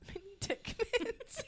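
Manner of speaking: high, soft, drawn-out giggle; helpless quiet giggling on the words. Real, not acted. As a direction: squeak it out slowly, losing it to laughter. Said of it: "it just gets funnier".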